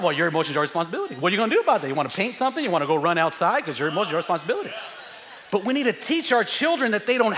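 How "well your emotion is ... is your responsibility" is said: put-on very fast playful lecture to a kid; dad firing back at a bored kid, fast; rapid dad reply. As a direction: rattle it off at speed. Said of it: "I'm bored".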